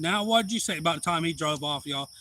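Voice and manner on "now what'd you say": higher, louder confrontation; squaring up to the man who insulted him; retold challenge. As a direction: dare him to repeat it. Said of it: "I said".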